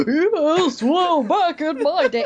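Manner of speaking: high, slow mock old man. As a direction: ham up a clichéd old geezer. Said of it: "hear them try to do, like, an old man".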